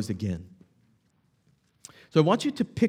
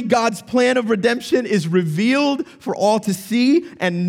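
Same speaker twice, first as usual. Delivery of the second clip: higher, louder, exultant preaching; triumphant proclamation; 7 semitones higher, 4 dB louder than usual. Acted. Preacher proclaiming the worthy king to the church, exultant. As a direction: ring it out like a victory announcement. Triumphant climax, repeated "Finally.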